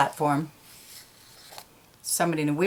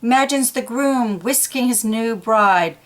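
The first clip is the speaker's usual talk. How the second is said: lively storytime narration, pitched a touch higher; animated storyteller voice for young listeners; reading to children; 4 semitones higher than usual. A narrator's reading. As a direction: read it like a picture book to kids. Reading picture book aloud.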